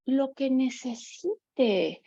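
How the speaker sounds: drawn-out, weighty "Lo que necesite"; slow emphatic answer. Acted. Speaker telling parents how long a child may cry, slow and weighty. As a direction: let each word of "Lo que necesite" land slowly.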